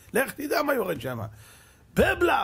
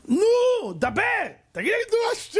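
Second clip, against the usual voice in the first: very high, loud demanding cry; impatient shout; 3 dB louder, slower than usual. Retold or imitated. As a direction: scream at heaven to answer now. Manasseh demanding God answer. (צעקה תובענית וגבוהה מאוד)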